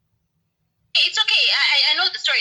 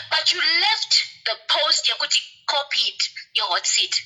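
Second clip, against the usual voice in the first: ordinary talk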